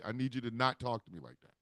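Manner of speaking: ordinary talk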